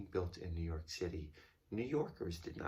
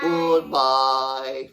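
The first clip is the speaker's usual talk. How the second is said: loud goodbye; 5 semitones higher, 17 dB louder than usual. Real, not acted. Echoing child, loud.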